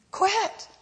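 a sudden high "Quit."; sharp command. Acted. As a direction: bark it out like the enemy. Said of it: "what the enemy wants you to do".

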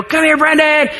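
high, bright call across the preschool room; calling to his son. Retold or imitated. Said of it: "little door and I go".

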